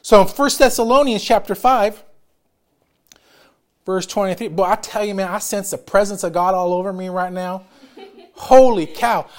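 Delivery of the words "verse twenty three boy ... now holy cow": ordinary delivery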